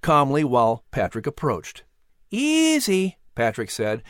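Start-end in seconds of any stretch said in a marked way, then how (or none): 2.3-3.2 high, drawn-out soothing word; calm a skittish bird in a boy's high voice. Acted. Boy calms the eagle.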